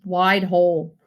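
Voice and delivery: ordinary delivery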